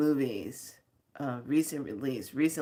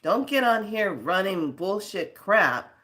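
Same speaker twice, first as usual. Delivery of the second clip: normal talking voice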